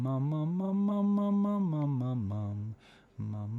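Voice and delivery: mum slides up high and back down; siren on mum. Shown as a demonstration. Coach demonstrating light compression on mum. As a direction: glide the mums up into head voice and back. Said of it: "learning to do this, to talk very quietly".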